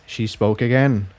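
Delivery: ordinary delivery, nothing marked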